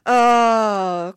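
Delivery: high, loud drawn-out sympathetic aah; audience sympathy. Retold or imitated. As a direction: moan along with the crowd. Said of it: "chorused the audience again".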